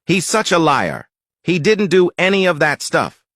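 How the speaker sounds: exasperated owner. Acted.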